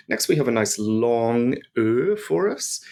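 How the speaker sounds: ordinary delivery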